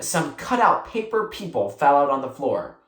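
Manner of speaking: ordinary delivery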